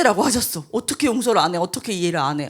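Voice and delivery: ordinary talk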